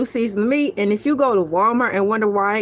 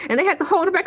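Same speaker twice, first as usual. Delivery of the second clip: ordinary talk, nothing marked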